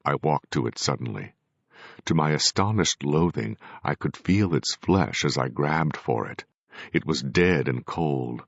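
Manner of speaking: ordinary delivery, nothing marked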